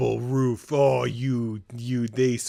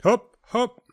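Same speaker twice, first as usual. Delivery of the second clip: high, louder quick hop calls; eager urging; 11 semitones higher, 7 dB louder than usual. Real, not acted. Player urging the character to hop, eager calls. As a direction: coax the jump.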